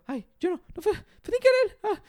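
very high, loud squealing denials; hammed-up frightened child, building to a loud squeal; panicked kid. Little neighbor denying he hurt the cousin, panicked kid.

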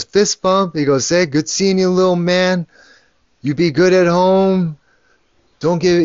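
a hint of an uncle cooing at a baby; parentese. Uncle greeting the baby boy, lightly mimicked parentese. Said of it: "Cousin doesn't speak with the same parentese".

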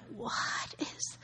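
slow, incredulous whisper; whispered aside of disbelief; stunned whisper. Retold or imitated. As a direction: breathe it out in disbelief. Retold disbelief at airport agent.

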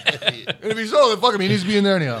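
ordinary delivery

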